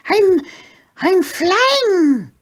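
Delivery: very high and loud, breathless joy; a pug lifting off for the first time; high pug voice, ecstatic; thrilled squeaky pug. A narrator's reading. Porky's voice, thrilled.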